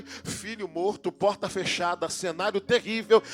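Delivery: loud, high, rising sermon climax; preaching at full force. Acted. Preacher shouting over the church about Mary's tears.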